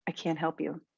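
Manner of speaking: low, soft, firm refusal; composed low-pitched statement; unapologetic. Shown as a demonstration. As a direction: say it low and quiet, without apology. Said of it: "omit the word sorry from these statements and it'll have more power".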